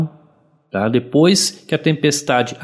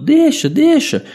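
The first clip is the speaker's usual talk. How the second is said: put-on grumbling kid; 12 semitones higher than usual. Grumbling child voiced.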